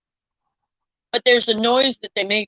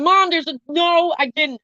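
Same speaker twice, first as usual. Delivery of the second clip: retold panicked protest; 6 semitones higher than usual.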